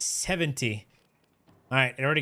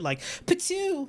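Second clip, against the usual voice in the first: gun noise; 14 semitones higher than usual. Pretend. Imitates a weapon shooting.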